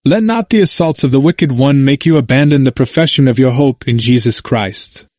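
plain reading voice

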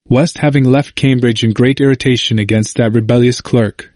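ordinary delivery, nothing marked

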